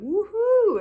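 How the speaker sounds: loud playful woohoo; mock cheer. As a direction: cheer it like riding a high. Mimicking highs of the spiral.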